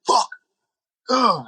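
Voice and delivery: sharp swear, then a high groan; frustrated curse. Retold or imitated. Player who just got tapped, cursing in frustration. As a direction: spit it out after getting tapped. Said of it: "When you get tapped, you go like".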